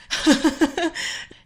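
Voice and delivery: high amused giggle; short real laugh. Real, not acted. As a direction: laugh at your own silly joke. Laughs at own unicorn joke.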